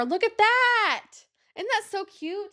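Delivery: high, slow, delighted exclamation; excited. Real, not acted. Vlogger shows her tree to viewers, delighted and high. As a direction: gush over the cute charm bracelets.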